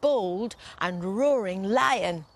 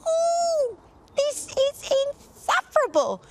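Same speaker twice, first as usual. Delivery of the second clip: very high, loud squeaky worm voice; squirming worm; 5 dB louder than usual. Acted. Dragon turned worm squeaks in outrage, very high. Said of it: "squirmed the worm".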